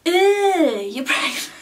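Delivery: high, loud mock disgust; hammed-up teen brother disgust; grossed-out brother. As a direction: squeal it like a grossed-out little brother. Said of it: "he went".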